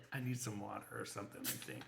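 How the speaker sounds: fading giggle while trying to recover; giggly and soft. Real, not acted. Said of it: "I can't stop laughing".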